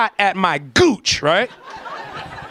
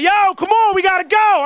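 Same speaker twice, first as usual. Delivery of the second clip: high, urgent call across water; impatient calling; 8 semitones higher than usual. Retold or imitated. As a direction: hurry the straggler along. Group calling out to slow swimmer.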